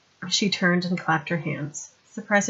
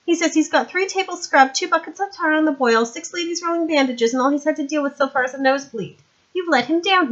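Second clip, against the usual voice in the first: put-on higher, louder young woman, brisk; young woman's voice; 9 semitones higher, 6 dB louder than usual. Sandra explaining to Vimes how she got there, higher young woman voice. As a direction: play her bright and eager.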